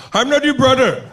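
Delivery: high, indignant mock Indian accent; huffy Indian-accented protest; offended Indian man. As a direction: snap back in a put-on Indian accent. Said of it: "they get offended".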